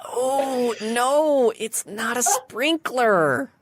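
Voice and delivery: bubbly letdown for a wrong guess; perky sphinx. Acted. Sphinx gently rejecting the sprinkler guess, high and bubbly.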